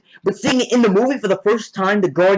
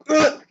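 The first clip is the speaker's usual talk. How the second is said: short high grunt of impact; acted-out "ugh"; imitated grunt; 10 semitones higher than usual. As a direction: mimic getting hit, pitched up. Acting out Iron Man being hit.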